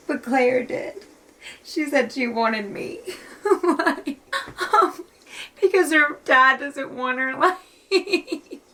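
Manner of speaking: high, breaking voice with teary laughs; laughing through tears. Real, not acted. Reader heartbroken for an unwanted girl, laughing through tears. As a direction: choke it out between crying and laughing. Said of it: "I'm laughing, but it's not funny".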